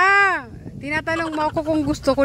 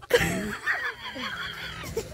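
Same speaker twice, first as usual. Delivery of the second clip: high squealing laugh, trailing softly; burst of laughter; 11 dB quieter than usual. Real, not acted. Retelling how the tough guy went soft, helpless laughter. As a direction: crack up into a high-pitched giggle.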